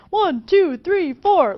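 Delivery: shouted high count-in like a drummer. Retold or imitated. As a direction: call out the count loud and high to start the band. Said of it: "the drummer goes".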